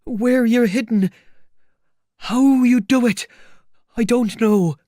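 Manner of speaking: very high, loud complaint; exasperated demand. Acted. Tramp to the invisible man, loudly exasperated. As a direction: cry out each demand, high and baffled.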